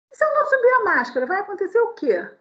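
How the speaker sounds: high, fast defiant retort; defiant challenge. Retold or imitated. Preacher retelling how she defied the rude man, high and fast. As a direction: fire back the challenge. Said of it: "virei pra ele e falei assim".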